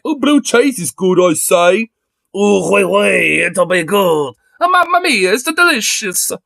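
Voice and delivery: high, hammed-up mock European; mocking European accents. Vlogger mocking Europeans praising blue cheese, hammed-up accents. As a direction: ham up a snooty European then an Italian. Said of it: "decide to be like".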